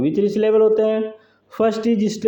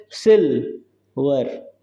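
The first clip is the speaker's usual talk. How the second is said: Silver slowly split, सिल held, वर falling; stressed first syllable; 4 semitones lower, much slower than usual. Shown as a demonstration. Teacher showing a trochee to students, silver stressed on सिल. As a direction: stretch सिल in silver, pause before वर. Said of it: "ये stress level है और ये unstress level है".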